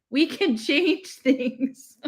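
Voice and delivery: laughing through the words; slow, laughing plea. Retold or imitated. Earnest plea breaks into laugh.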